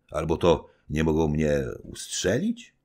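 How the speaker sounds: ordinary delivery, nothing marked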